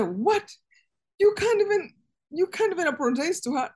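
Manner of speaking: retold angry outburst, pitch jumping up; indignant anger. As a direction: imitate an outraged jail chief scolding. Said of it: "So he got so mad".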